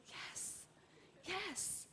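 soft whispered yes of quiet triumph; hushed yes. Real, not acted. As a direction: breathe it out like a secret victory. Mom's inward triumphant reaction.

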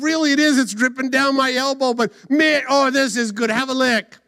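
moaning with delight over food; over-the-top ecstatic eater; hammed-up relish. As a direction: eat greedily, then call out high and loud. Samson devours honey, calls parents.

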